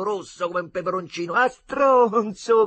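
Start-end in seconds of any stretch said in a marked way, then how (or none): 1.3-2.7 loud, high, slow yell; shouted insult. Acted. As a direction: holler it at the boat. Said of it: "gridarono i ragazzini". (insulto gridato)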